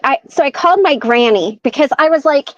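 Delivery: ordinary talk, nothing marked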